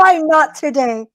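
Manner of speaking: high, pushed-out question; impatient storybook coyote; demanding coyote. Acted.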